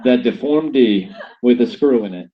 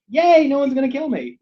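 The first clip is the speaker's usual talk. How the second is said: mock relieved cheer; 11 semitones higher than usual. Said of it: "and you're like".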